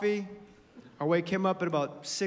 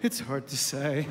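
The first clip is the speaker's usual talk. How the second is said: put-on low, deflated little boy; defeated kid mumble; 8 semitones lower than usual. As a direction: sigh it out like a beaten kid. Son's defeated answer again.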